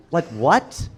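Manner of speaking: normal talking voice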